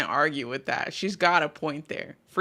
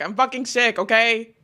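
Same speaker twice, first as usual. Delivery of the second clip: exasperated outburst; 4 semitones higher, 6 dB louder than usual. Real, not acted. Exasperated complaint to chat.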